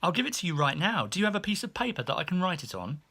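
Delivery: quick, chirpy maid voice; eager maid. Retold or imitated. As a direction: rush it like an eager fan. Eager maid pushes for tickets.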